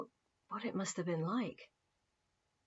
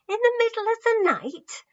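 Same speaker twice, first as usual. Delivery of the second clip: shocked disbelief; 12 dB louder than usual. Acted. Several people shocked at the news.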